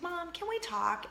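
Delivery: lightly voiced twelve-year-old asking mom to talk; daughter asking. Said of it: "She's like".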